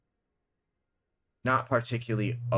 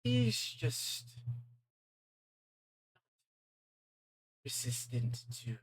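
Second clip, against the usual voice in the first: a purring, amused British-tinged demon, softer than the narration; sly villain voice; 4 semitones higher, 6 dB quieter than usual. Acted. Game master voicing the demon greeting the sheriff, silky and mocking. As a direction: savor every word like a smug villain.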